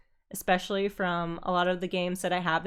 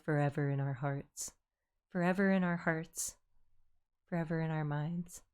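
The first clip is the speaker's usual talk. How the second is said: much softer, low mock eulogy; mock mournful; 6 semitones lower, 9 dB quieter than usual. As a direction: hush it like a fake memorial.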